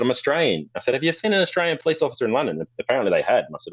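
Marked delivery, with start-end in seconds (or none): 0.0-2.6 high, quick, disbelieving retort; playful mock disbelief; incredulous. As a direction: point out how absurd the claim is. Retold clash with squat bully.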